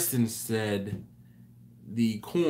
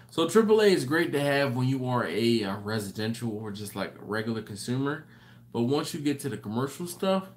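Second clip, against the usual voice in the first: normal talking voice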